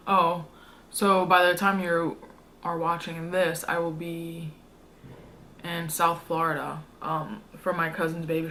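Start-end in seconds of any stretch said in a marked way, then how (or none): none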